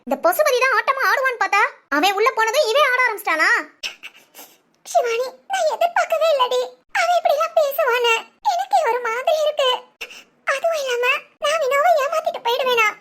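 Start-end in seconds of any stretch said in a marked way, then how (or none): 4.9-12.9 broken sobbing, higher voice. Acted. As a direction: sob out the hurtful words. Hurt girl sobbing to friend. (தோழியிடம் அழுதபடி சொல்லும் பெண், விம்மல் குரல்)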